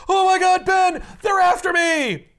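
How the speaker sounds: high, loud hammed-up panic; comic frantic cry for help to a friend; mock panic. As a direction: yell it like you're being chased. Hero crash-lands fleeing pursuers.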